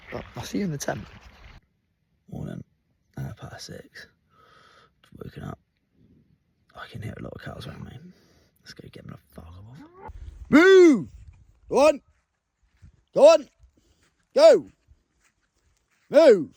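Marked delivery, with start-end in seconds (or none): 2.3-10.1 unvoiced morning whisper; hushed dawn whisper by the tent. Real, not acted. Early morning, cows around tent. 10.5-16.5 loud high shouts; real shouts chasing off cows; shouting at cows. Real, not acted. Chasing cows away from tent.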